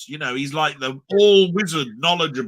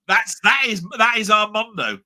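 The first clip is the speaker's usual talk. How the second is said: put-on Geordie accent; 7 semitones higher, 5 dB louder than usual. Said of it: "I can't do the accent".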